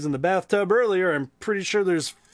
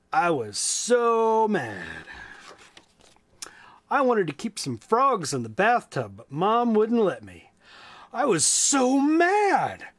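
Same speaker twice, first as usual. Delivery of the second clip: lively, high storybook voice; reading to children; 7 semitones higher than usual. A narrator's reading. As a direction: read it big and bright for kids. Reads a children's book aloud.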